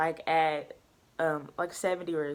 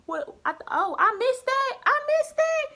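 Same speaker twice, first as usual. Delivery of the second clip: shocked disbelief; 6 dB louder than usual. Retold or imitated. Shocked at the wrong answer.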